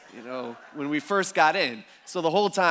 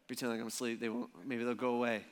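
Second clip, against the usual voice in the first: softer, low, hushed aside; hushed pretend sleep; 5 semitones lower, 9 dB quieter than usual. Parent pretending to sleep as kid barges in, hushed. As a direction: mutter to yourself to stay hidden under covers.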